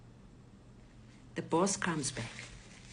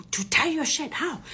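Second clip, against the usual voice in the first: higher, louder baffled echo; incredulous retold question; 9 semitones higher, 4 dB louder than usual. Robber baffled by the cufflinks, incredulous echo.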